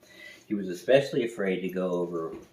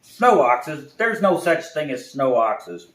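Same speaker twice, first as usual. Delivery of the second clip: louder, higher, slow correction; scolding owl; 6 semitones higher, 9 dB louder than usual. Acted. Owl correcting the bear, loud scoffing. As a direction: scoff at the nonsense. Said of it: "He said".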